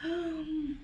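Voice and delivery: soft, high gasp of dismay; mock gasp. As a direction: gasp as if hearing bad news. Mimes reaction to the bloodbath warning.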